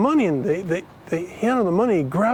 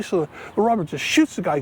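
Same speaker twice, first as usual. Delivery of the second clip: normal talking voice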